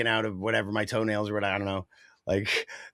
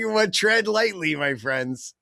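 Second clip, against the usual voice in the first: mock warning; 7 semitones higher, 4 dB louder, much slower than usual. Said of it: "I'm like".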